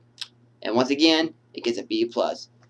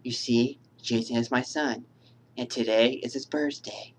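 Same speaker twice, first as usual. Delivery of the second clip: Mrs Voorhees; 8 dB quieter than usual. Acted. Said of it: "like from Miss Voorhees when she says".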